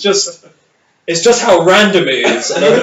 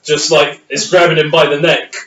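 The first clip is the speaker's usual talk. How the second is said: usual speaking voice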